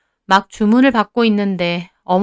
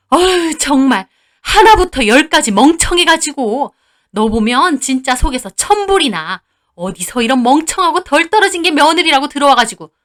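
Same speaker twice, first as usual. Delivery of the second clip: higher, heated grumbling with a sigh; exasperated berating; 5 semitones higher, 4 dB louder than usual. Acted. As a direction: vent disgust at the daughter-in-law. Mother-in-law berates daughter-in-law. (속 터진다는 듯 구박하는 시어머니)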